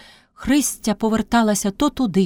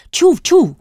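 high, louder hurried reply; breaking free; 10 semitones higher, 5 dB louder than usual. A narrator's reading. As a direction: blurt it high, as if tugging loose. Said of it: "вириваючись, мовив дяк". (високо, вириваючись)

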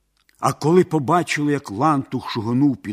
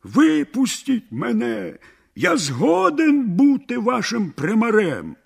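crying out; 8 semitones higher than usual. A narrator's reading. Merchant in the sack begs the villagers for release, crying out. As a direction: call out high to be let go. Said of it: "він заволав".

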